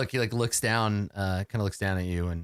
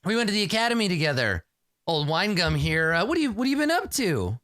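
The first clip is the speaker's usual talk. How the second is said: raised, performed friendliness for the crowd; showy noble; 7 semitones higher than usual. Acted. Keeb introducing Winnegar to the crowd, pitched up and showy. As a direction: show off your old pal to the group. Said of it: "he says".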